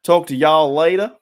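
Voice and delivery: loud mock Southern accent farewell. Joking goodbye to viewers, loud put-on Southern accent. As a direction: sign off in a playful Texan accent. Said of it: "The Southern hospitality accent".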